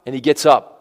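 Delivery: usual speaking voice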